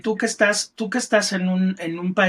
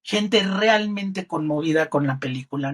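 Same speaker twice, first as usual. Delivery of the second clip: ordinary delivery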